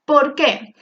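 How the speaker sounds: normal talking voice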